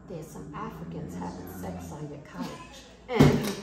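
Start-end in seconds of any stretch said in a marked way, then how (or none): 0.1-2.5 low hushed mock whisper of an old woman; hushed confiding. Granddaughter imitating grandma's hushed secret. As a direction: lean in and murmur it like a secret. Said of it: "She goes".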